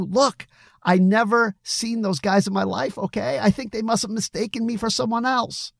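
high-pitched, agitated denial. A narrator's reading. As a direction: insist anxiously, pitched well up. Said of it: "Billy was visibly agitated".

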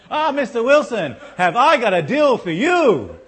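high, loud hammed-up Indian accent, laughing through it; mock call centre accent. Preacher mimics the salesman calling Tim, laughing and loud. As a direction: play the pushy salesman with a put-on accent. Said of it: "work with the accent anyway".

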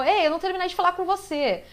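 lightly acted game dialogue; imitated game hero. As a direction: quote the hero calling after someone. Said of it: "geralmente ele faz o".

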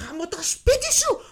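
swearing after a maddening phone call; angry outburst. Retold or imitated. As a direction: blow up and curse her out. Retells her angry outburst.

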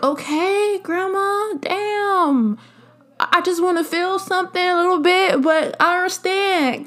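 put-on high-pitched, put-upon teen protest; mock exasperation. Teenager answering strict grandma, mock exasperated. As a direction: imitate a grandchild giving in, pitched well up.

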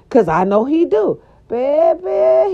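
ordinary delivery

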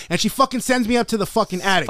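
usual speaking voice

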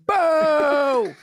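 boo the comic off like a heckler; long high jeering boo; old-fashioned heckle. Acted. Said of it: "by just going".

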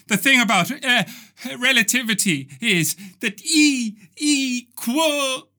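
solemn visitor from another dimension, growing urgent; lightly voiced alien messenger. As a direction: warn the people of Earth before you explode.

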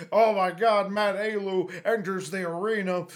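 loud hyped ring announcer; wrestling announcer. Retold or imitated. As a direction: announce the entrance with hype. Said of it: "talking like a wrestling announcer".

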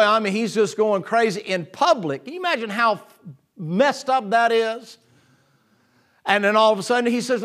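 usual speaking voice

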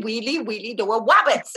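lightly voiced Elmer Fudd lisp; a hint of a cartoon hunter. Imitating the cartoon's way of talking, a light lisp. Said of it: "Elmer Fudd talk wheelie".

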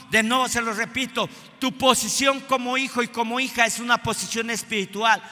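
escalating shouted sermon; full-force preaching. Acted. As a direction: preach it hard, rising each line.

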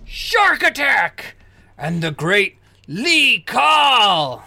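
slow, drawn-out ring introduction; big announcer call; fight announcer. Acted. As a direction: stretch the fighters' names for the arena. Game master announces next fight.

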